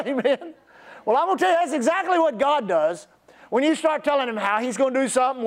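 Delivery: normal talking voice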